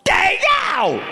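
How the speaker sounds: very high shrieked impression of a woman; meth-head woman. Woman in line turns around to him, high shriek. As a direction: screech it in a falsetto yell. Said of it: "she turns around, she looks at me, and she goes".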